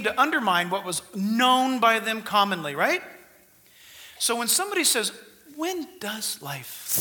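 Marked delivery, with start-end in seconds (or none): 5.6-7.0 slow hissing serpent voice; drawn-out, slithering snake. Acted. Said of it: "That's supposed to be a snake voice".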